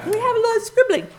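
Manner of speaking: high, fast, laughing through it. Real, not acted. Minister to congregation about dead printer, laughing. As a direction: giggle at your own mess.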